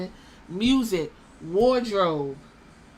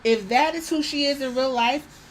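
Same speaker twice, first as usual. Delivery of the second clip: ordinary talk, nothing marked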